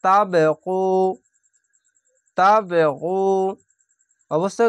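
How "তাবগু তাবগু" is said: তাবগু with the b bounced out in a small echo; তাবগু, b with a qalqala echo; qalqala bounce. Shown as a demonstration. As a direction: knock the b in তাবগু so it rebounds. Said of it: "কলকলাটি ধাক্কা মেরে নিচে sound আসবে".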